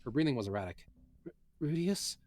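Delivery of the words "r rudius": put-on high, breathy, faltering girl; weak Eris. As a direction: ask his name weakly, stumbling. Eris voiced, breathing erratic.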